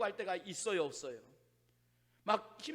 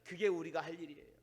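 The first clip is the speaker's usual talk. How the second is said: ordinary delivery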